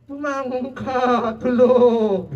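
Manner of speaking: slow, drawn-out bumangon ka; rousing a sleeper. Retold or imitated. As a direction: coax the sleeper awake. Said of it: "ang sabi ng sailor".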